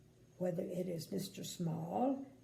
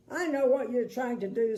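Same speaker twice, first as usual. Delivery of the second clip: grumpy man voice; 3 semitones higher, 8 dB louder than usual. Acted.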